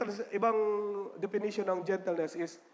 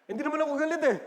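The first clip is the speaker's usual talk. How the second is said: high-pitched testy protest; indignant mock denial; 8 semitones higher, 3 dB louder, much faster than usual. Offended person denying anger after an apology, mock indignant. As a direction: protest too much, playfully. Acted denial of being angry.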